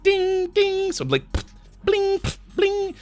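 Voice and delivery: high ringing bell sounds; high bell dings. Retold or imitated. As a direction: squeak out high pings like a bell. Imitating gun hitting bell.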